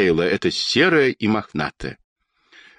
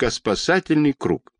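ordinary delivery

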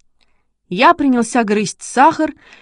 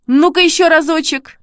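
high, eager call; excited urging; 7 semitones higher, 4 dB louder than usual. A narrator's reading. Boy wants his dog to repeat it, excited. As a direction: urge the dog on keenly.

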